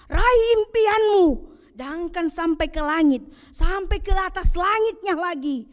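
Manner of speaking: high, loud, building exhortation; rising rallying cry. Acted. Preacher urges the congregation to reach for the sky, rising shout. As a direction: lift them up, climbing to a shout. Rousing call to reach dreams.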